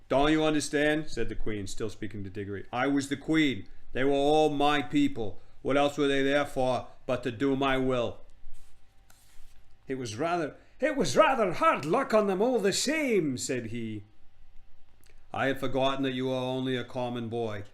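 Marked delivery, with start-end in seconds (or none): 0.1-1.1 raised, louder regal voice; imperious and impatient; haughty queen. Acted. 2.7-8.2 grand, emphatic regal declaration; hammed-up royal self-importance; haughty queen. 9.9-13.4 hammed-up little boy objecting; protesting boy.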